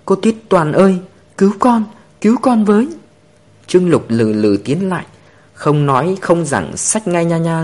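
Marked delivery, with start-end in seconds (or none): 0.0-2.9 put-on high, loud child's cry for help; a scared little girl crying out to be saved; 哭喊求救的小女孩; wailing child. A narrator's reading. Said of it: "khóc toáng lên".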